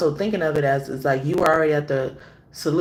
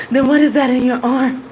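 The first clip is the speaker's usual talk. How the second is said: loud, raised, breathless demand; 7 semitones higher, 7 dB louder than usual. Retold or imitated. Winded runner quizzing a dog owner, loud breathless imitation. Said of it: "all out of breath".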